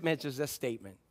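normal talking voice

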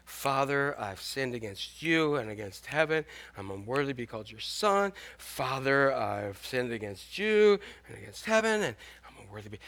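quiet nervous practising under the breath; mumbled rehearsal. Retold or imitated. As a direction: mutter the apology over and over to yourself. Son quietly rehearsing apology.

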